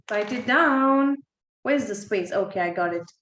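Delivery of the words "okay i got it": ordinary talk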